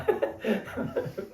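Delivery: a high, bursting laugh of joyful bliss; retold ecstatic laugh. Reliving his initiation bliss for the listener, ecstatic laugh. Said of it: "went into joyful laughter".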